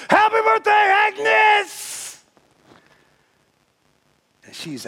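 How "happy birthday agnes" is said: much higher, loud birthday scream; screaming surprise. Retold or imitated. Crowd surprising Agnes on her birthday, screaming. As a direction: yell it like a surprise party. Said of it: "they all scream".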